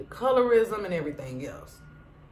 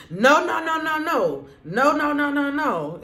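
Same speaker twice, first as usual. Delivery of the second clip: high, louder run of no's cutting her off; mock shutdown; 7 semitones higher, 3 dB louder than usual. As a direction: imitate her shutting the friend down. OG shutting Jen down.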